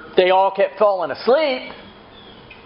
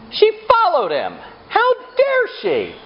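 mock outrage. Preacher mocking the servant girl's nerve, high indignant. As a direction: ham up the shock at her nerve.

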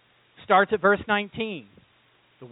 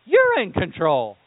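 loud surrender; 5 dB louder, slower than usual. Retold or imitated. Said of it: "finally goes".

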